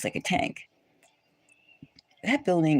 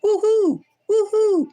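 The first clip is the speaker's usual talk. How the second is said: a much higher, much louder hammed-up whoop; mock cheer; 7 dB louder than usual. Mocking the conditioned listener with a loud fake whoop. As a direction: whoop it up like a mindless party crowd. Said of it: "and to go".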